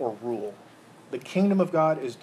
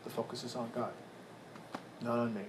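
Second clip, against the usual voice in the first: usual speaking voice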